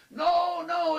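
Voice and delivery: high, loud acted cry of no; alarmed cry. Mother calls after her runaway child, alarmed cry.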